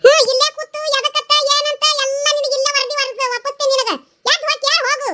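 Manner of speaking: high-pitched exasperated mocking rebuke; nagging mother sending her daughter away; irritated scolding. Mother scolds daughter for asking why.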